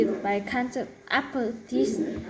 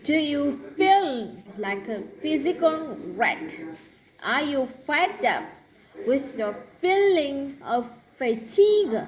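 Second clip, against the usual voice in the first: lightly voiced TV medicine ad, higher; playful commercial read; mock ad read; 3 semitones higher than usual. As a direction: sell it like a cold remedy commercial. Reads an ad with F words.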